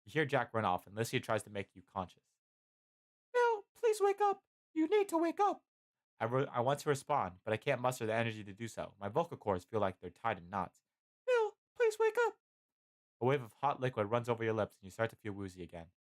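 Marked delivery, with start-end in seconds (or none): 3.3-5.6 very high falsetto plea, louder; pleading Licia. A narrator's reading. Licia tries to rouse dying Bill, pleading falsetto. 11.2-12.4 very high falsetto plea, louder; pleading Licia. A narrator's reading. Licia tries to rouse dying Bill, pleading falsetto.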